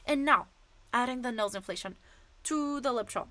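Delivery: ordinary delivery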